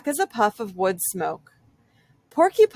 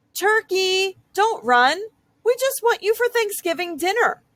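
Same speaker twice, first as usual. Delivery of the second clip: calling after him; 10 semitones higher than usual. Acted. Moose chasing fleeing turkey.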